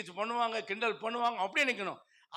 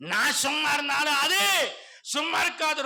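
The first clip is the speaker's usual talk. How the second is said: loud, high, rising to அது then falling on இருக்காது; shouted refrain; 6 semitones higher, 5 dB louder than usual. Real, not acted. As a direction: throw the refrain out at the crowd. Preacher repeats the refrain. (உரக்கக் கூவிய Pallavi)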